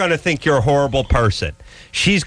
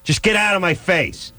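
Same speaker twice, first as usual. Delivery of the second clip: mock yell; 7 semitones higher than usual. Voicing someone yelling at neighbors.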